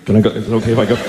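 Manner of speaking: lightly voiced dimwitted deer, low and halting; mock timid creature asking permission; low, unsure mock animal voice; hesitant deer.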